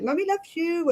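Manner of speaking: put-on high doting baby talk; baby talk to cat. Talking to her cat in high baby talk. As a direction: coo it up high to a pet.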